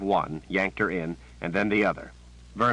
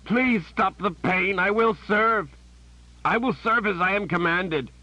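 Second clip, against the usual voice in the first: pained begging; 13 semitones higher, 4 dB louder than usual. A narrator's reading. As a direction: beg for the pain to stop. Warren begging under torture.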